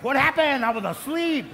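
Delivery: high and loud mock confusion; mock sleepy congregant. Pastor teasing sleepy congregants, high and loud. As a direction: play the dozing listener. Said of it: "Some of you guys are like".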